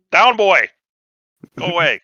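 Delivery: half-joking stern dog command; mock scolding a dog. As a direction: shoo him off like a pesky dog. Shooing creep like a dog.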